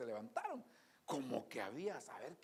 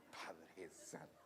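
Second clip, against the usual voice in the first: drawn-out, stunned low exclamation; astonished slow sigh; 10 semitones lower, 9 dB quieter, much slower than usual. Retold or imitated. Preacher recalling his dismay at the long line, slow and low. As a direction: let it out slowly, dismayed. Shocked aside repeated.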